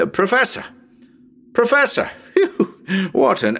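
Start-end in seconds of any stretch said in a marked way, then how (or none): 0.1-2.1 high, ringing calls to someone far off; calling out. Real, not acted. Calling out to the distant professor. 2.2-3.1 laughing. Real, not acted. Laughs after the echo.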